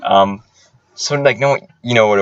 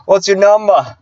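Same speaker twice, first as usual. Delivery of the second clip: high, insistent Aussie impression; pushy Australian accent; 9 semitones higher, 4 dB louder, slower than usual. Pushy coworker asking for phone number, Australian accent. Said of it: "He was, like, really pushy".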